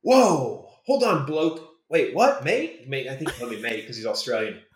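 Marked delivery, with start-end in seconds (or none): 0.0-2.9 imitated startled movie star, raised pitch; mock double-take, loud opening whoa; startled reaction. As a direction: do a taken-aback bloke, higher voice. Retelling star's startled reaction.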